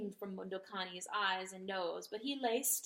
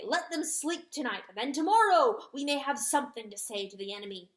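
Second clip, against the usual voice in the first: loud, raised urgent tirade; fierce rooster rant; 4 semitones higher, 6 dB louder than usual. A narrator's reading. Rooster warning the weeping dog of war. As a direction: hammer the warning home.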